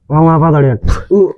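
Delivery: moaning from burning heat; pained cries. Acted. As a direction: gasp and moan as if scorched. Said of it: "Keenakan apa kepanasan".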